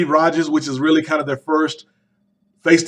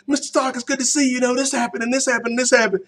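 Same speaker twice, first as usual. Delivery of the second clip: put-on much higher, eager kid voice rattling on; excited Peter Parker; 9 semitones higher, faster than usual. Reviewer imitating Peter babbling to Mister Stark, high and eager. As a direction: play the rambling eager teen. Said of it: "Peter's like".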